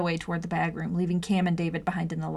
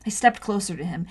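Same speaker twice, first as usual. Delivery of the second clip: ordinary delivery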